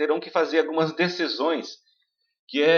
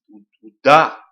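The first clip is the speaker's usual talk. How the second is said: dá much louder; stress on dá; 13 dB louder than usual. Shown as a demonstration. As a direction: slam the dá. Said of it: "Essa sílaba é forte". (dá bem forte)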